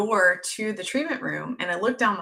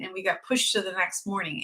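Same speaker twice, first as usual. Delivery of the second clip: normal talking voice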